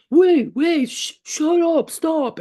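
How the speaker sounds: high, urgent hushing voice; alien hushing. Retold or imitated. Giant alien silencing a screaming elf, urgent high voice. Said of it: "talked to the ten-foot alien".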